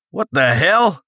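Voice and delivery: a confused yelp at the strange object; startled. Acted. Blake startled, pulls out cylinder.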